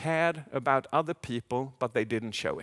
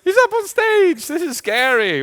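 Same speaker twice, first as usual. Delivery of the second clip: much higher, louder voice imitating a worried listener; nervous audience voice; 11 dB louder than usual. Speaker voicing the audience's worried thoughts, high and loud. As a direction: squeak it out like an anxious audience member. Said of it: "So I'm like".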